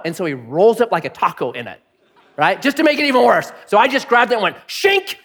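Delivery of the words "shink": high-pitched "shink" swish; sharp high imitated whoosh; yanking sound effect. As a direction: voice the blanket being whipped away. Imitates yanking the blanket away.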